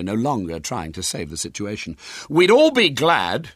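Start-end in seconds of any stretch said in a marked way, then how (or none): 2.3-3.5 high, loud, slow indignation; pointed, raised-voice complaint; indignant. A narrator's reading. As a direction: draw it out in boyish outrage. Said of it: "he said indignantly".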